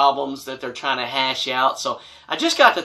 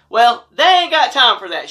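higher, louder dismissive woman voice; mock church lady, higher and louder; mocking the mom; 8 semitones higher, 8 dB louder than usual. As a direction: snap it back as the brushing-off mom. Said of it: "she said".

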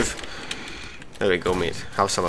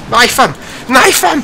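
urgent shout; 8 dB louder, slower than usual. Real, not acted. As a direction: shout it high and loud at a teammate. Calling out to teammate mid-fight.

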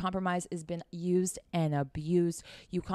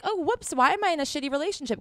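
high-pitched mock-innocent bewilderment; mock clueless; 7 semitones higher, 4 dB louder, faster than usual. Mimicking followers who compromise then get mistreated, mock clueless. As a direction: play dumb, pitched up.

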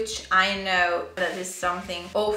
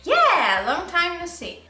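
excited; 7 semitones higher than usual. Real, not acted. Delighted to recognize phrase.

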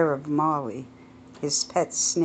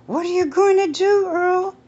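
put-on high, loud, panicky older woman; shrill-free high fearful wife voice; alarmed Edith; 6 dB louder than usual. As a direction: gasp it to her husband in alarm. Edith demands action.

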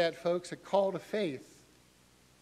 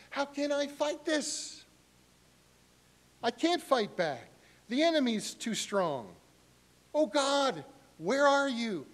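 higher, pleading prayer; helpless cry; 9 semitones higher, 3 dB louder than usual. Retold or imitated. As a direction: sound cornered and desperate. Voicing helpless believer praying.

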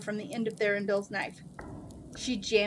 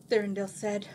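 usual speaking voice

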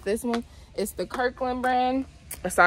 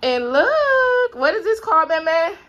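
thrilled, near tears; 9 semitones higher, 4 dB louder than usual. Real, not acted. Mom sees son's new bunk bed.

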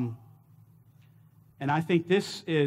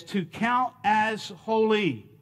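slow, careful to count as holy; deliberate gloss; 4 semitones higher, much slower than usual. Shown as a demonstration. Pastor giving a paraphrase to the congregation, slow and deliberate. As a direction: spell out the meaning word by word. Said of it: "we could say it like this".

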